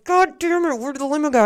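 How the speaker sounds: high-pitched exasperated quote; retold freak-out. Bride looking for the missing limo, freaking out.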